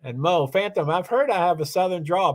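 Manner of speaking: ordinary talk, nothing marked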